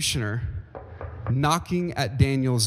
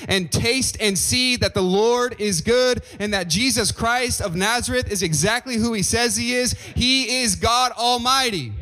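full-force preaching; 7 semitones higher than usual. Acted. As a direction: preach it out, building to God Almighty.

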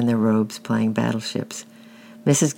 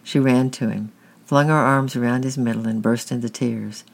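plain reading voice

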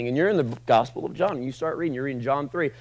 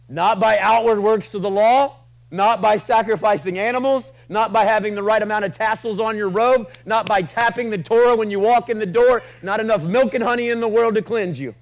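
higher, louder, driving list; preaching at full force; 8 semitones higher, 7 dB louder than usual. Acted. Preacher to the congregation, building to full force. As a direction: hammer each not by home.

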